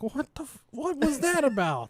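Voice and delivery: high, baffled "what was that about"; confused teen. Retold or imitated. Confused teen, pitch way up, puzzled by her mom. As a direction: play the baffled sister, pitch way up. Confused sister voiced in story.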